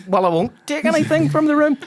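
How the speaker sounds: high, loud mock-indignant retort; indignant protest. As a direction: protest loudly, pitched right up. Retold hotel deposit argument.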